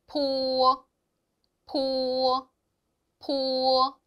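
포 with a clear aspirated p; Chinese po syllable. Shown as a demonstration. Teacher drills po for the class to repeat. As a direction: repeat 포 for students to copy. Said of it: "이렇게 발음을 합니다".

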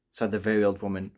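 ordinary delivery

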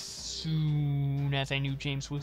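low, hushed, brooding read; mock-grave soft narrator; dramatic narration. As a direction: murmur it like a dark revelation. Reads document as solemn narration.

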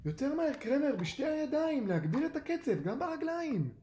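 high-pitched coaching shout; urgent high instructor's holler; calling out. A narrator's reading. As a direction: yell from afar to speed the swimmer up. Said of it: "וקרא".

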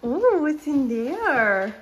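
high, slow, swooping coo; curious pet talk. Real, not acted. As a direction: wonder aloud to a cat, drawn out. Cooing curiously to the cat.

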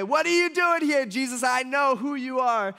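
high, loud, panicked demon cry; a cornered demon blurting out in alarm; shrill-free but high, frightened outburst; startled demon. Retold or imitated. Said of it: "they run up to Jesus and say".

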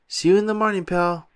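plain reading voice

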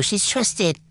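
ordinary talk, nothing marked

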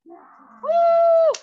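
long, piercingly high, loud woo; exertion whoop. Real, not acted. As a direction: whoop it out as the set ends. Cheering end of second set.

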